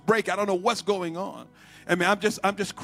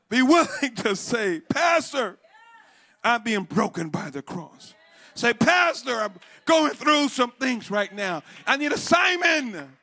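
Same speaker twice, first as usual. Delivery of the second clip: slow, raised exhortation with cried-out phrases; forceful preaching; 5 semitones higher, 3 dB louder, slower than usual. Real, not acted. Preacher modelling a plea to the pastor, forceful preaching. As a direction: cry out the plea, then press on.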